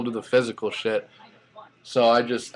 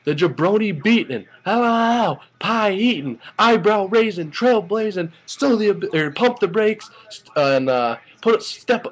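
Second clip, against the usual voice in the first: much higher, rapid-fire hype imitation of The Rock; excited fan reciting the Rock's jabroni catchphrase; The Rock promo; 10 semitones higher than usual. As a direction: rattle off the insults like a wrestling promo. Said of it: "he also did his".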